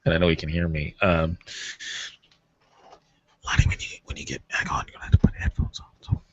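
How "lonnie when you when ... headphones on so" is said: breathy whisper to the caller. Real, not acted. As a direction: keep it a whisper, barely voiced. Whispers to caller while live.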